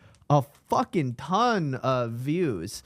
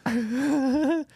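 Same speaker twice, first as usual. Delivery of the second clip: high laugh; 10 semitones higher than usual. Real, not acted. Reacts to delivered guy clip.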